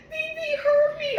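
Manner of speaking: put-on high, loud whiny toddler accusation; a little kid tattling. Aunt imitating toddler, high whiny tattle. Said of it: "he said".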